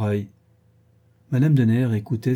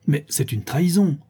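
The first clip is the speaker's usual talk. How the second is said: higher-pitched cry of protest; exclaimed protest; 5 semitones higher than usual. A narrator's reading. Caussade protests the waiting carriage, exclaimed. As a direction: cry out at being betrayed. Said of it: "s'écria monsieur de Caussade".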